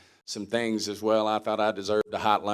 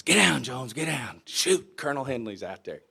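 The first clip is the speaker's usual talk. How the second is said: mock-panicked, raised-pitch warning; urgent warning; 5 semitones higher, 5 dB louder than usual. Buddy spots the colonel during lunch escape, urgent warning. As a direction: blurt it out like you're about to be caught.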